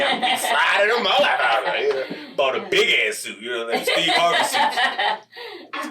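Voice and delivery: high laughing boast about the suit; giggling through the brag. Real, not acted. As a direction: crack up while bragging. Boasting about his big suit.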